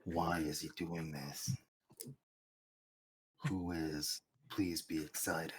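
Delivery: very low, soft, slow growl; Vin Diesel voice. Pretend. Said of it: "Vin Diesel voice".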